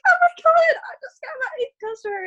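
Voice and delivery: very high, tearful squeal of joy; thrilled squeal. Retold or imitated. Telling her husband about a new customer, squealing high. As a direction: squeal it out, close to happy tears. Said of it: "I was just like".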